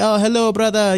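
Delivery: playful imitation of an Arabic accent. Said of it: "like, Arabic accent".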